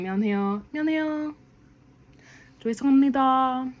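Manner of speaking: escalating politeness. Shown as a demonstration. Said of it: "like that".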